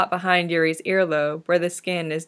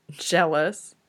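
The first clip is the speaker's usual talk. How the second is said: withering. A narrator's reading. Yurio needling Victor about Christoph, witheringly. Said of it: "Yurio asks witheringly".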